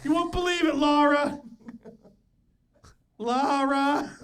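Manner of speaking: high, loud mock call to his wife; hammed-up tattling dad, calling out; imitation of dad. Son acting dad calling mom, mock holler. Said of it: "my dad just runs and tells her".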